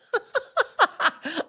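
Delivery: high, bubbly burst of laughter; amused high-pitched laughing fit; real laugh. Real, not acted. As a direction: crack up at your own story. Laughs at own absurd rant.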